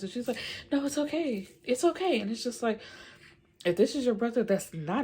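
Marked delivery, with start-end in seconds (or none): 0.7-2.2 high-pitched imitation of a girl reassuring; imitated high "no worries" voice; mock reassurance. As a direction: brush it off in a much higher voice. Retold girl reassuring upset boy.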